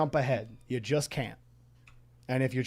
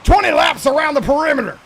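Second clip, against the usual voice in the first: barking drill sergeant, much higher and louder; 12 semitones higher, 11 dB louder than usual. Acted. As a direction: shout orders like a boot camp sergeant. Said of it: "you don't like my drill sergeant voice".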